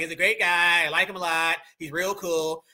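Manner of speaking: Donald Trump. Retold or imitated. Reactor contrasting plain Northern talk, Donald Trump impression. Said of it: "Donald Trump's just gonna be like".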